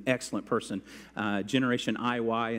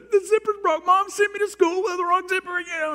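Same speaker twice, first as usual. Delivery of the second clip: put-on high, loud whiny child pleading; boy excusing himself to the teacher in a high kid voice; little kid voice; 6 dB louder than usual. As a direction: plead like a panicked five-year-old. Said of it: "I said".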